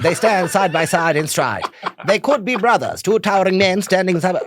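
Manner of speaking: high, loud newsreel commentator; grand vintage BBC announcer, pitched right up; old BBC voice. Acted. Reciting the De Gaulle newsreel to friends, old BBC voice. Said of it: "That's a cool voice".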